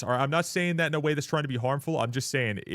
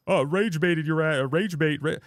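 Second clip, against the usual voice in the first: higher, louder mock catchphrase; jeering copy of a troll's excuse; mocking imitation; 5 semitones higher, 4 dB louder than usual. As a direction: parrot them mockingly, pitched up. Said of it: "They just hide behind the word".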